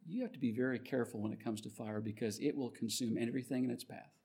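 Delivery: quiet, low word of caution; understated fatherly warning. Retold or imitated. Dad to his son after the garage fire, quiet warning. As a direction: imitate a father warning softly, without scolding.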